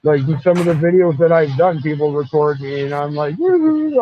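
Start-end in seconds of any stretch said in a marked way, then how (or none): 3.4-4.0 high-pitched clowning woo-hoo; playful whoop. Retold or imitated. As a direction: whoop it up like a goof. Reenacts clowning for the camera.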